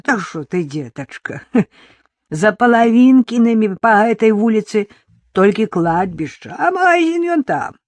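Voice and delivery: a chuckling country granny with a Belarusian lilt; old village woman. Acted. Old woman laughing at a lost city girl, chuckling.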